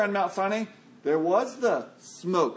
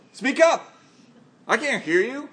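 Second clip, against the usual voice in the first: higher, louder, sharp demand to speak up; mock impatient shout; 6 semitones higher, 5 dB louder than usual. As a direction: bark it at a mumbler. Reacting to someone who whispers.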